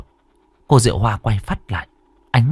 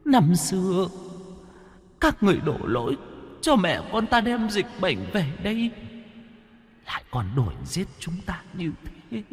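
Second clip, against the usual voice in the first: soft, eerie voice full of hatred; vengeful ghost; 11 semitones higher, 10 dB quieter than usual. Acted. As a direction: accuse them like a bitter ghost. Hồn ma căm giận kể tội. (giọng ma oán hận, nhỏ và rợn)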